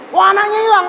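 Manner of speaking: high-pitched alarm over a loss; panicked. Acted. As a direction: cry out in acted panic. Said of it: "kan panik".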